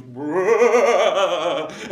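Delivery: loud trilled ghostly brrr; ghost noise. Shown as a demonstration. Showing the children how a ghost goes, a loud trilled brrr. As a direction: rattle out a spooky brrr. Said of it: "and it goes".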